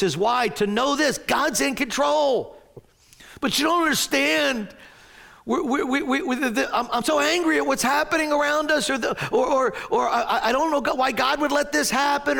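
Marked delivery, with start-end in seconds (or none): 3.4-12.4 mock upset protest. Voicing an upset listener's protest.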